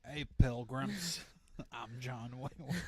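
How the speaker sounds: very slow, drawled cowboy voice; John Wayne impression. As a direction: drag out pilgrims like a western hero. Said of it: "I talk like John Wayne".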